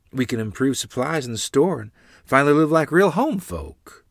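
excited mother, high and loud; bubbling, excited. A narrator's reading. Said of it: "Mother's voice bubbling out of her excitedly".

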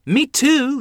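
high, loud piping; eager child. Acted. Little sister hoping for a treat, eager. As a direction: chime in hopefully.

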